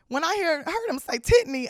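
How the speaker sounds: ordinary delivery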